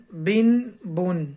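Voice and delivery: بن، بن drawn out slowly; slow syllable drill. Shown as a demonstration. Teacher slowly sounding out بن for the class. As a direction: sound out بن، بن carefully. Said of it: "لہذا اسے پڑھیں گے".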